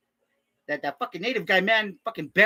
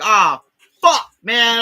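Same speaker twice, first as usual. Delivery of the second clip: anguished outburst; 3 semitones higher, 10 dB louder, much slower than usual. Retold or imitated. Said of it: "I was like".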